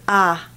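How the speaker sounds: "ah" with no glide; Southern monophthong I. Shown as a demonstration. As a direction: stop the vowel at ah. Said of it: "it just stops at the ah".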